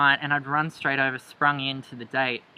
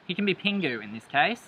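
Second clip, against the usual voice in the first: ordinary talk, nothing marked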